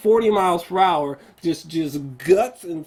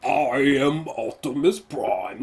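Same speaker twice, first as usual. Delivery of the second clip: slow, lightly voiced deep hero line; a loose Optimus Prime imitation; 3 semitones lower, slower than usual. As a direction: mimic the robot's grand self-naming. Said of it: "he's just back to being".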